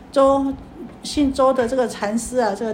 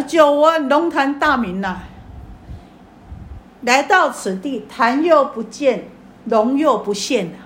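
slow, loud, higher call into the hall; 5 semitones higher, 6 dB louder, slower than usual. Retold or imitated. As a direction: declaim it slowly like a challenge. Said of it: "就这么喊了". (高声缓缓呼喊)

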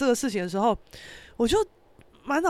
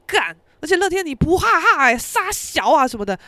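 higher, louder mock indignation; retold outburst; 6 semitones higher, 5 dB louder than usual. Scolding Letian over the missed "ha ha", mock-angry. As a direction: act out swearing at a friend in protest.

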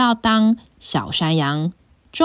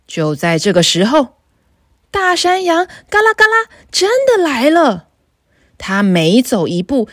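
usual speaking voice

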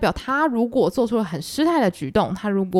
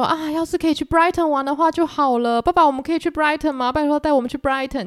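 high, loud pleading with papa; begging daughters; 7 semitones higher, 4 dB louder than usual. Retold or imitated. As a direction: whine like spoiled girls begging. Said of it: "他们就在讲说".

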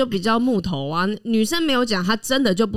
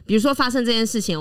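normal talking voice

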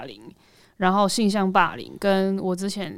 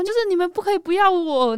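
high, louder re-enacted plea; retold desperate appeal, pitched up; pleading; 10 semitones higher, 5 dB louder than usual. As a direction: plead with parents not to abandon you.

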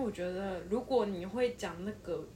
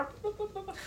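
high, quick imitated chatter; rapid babble noise; 13 semitones higher, much faster than usual. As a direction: rattle off like fast talkers. Imitating rapid-fire Mandarin speech. (模仿飞快说话声)

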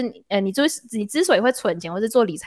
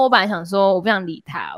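usual speaking voice

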